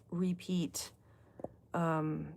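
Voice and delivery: usual speaking voice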